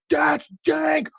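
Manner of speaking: high-pitched, drawn-out mock yell; yelling right into someone's ear; imitated shout over music. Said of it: "gets as close as he can to my ear".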